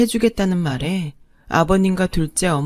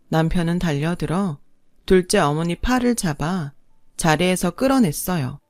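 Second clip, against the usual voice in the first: ordinary delivery, nothing marked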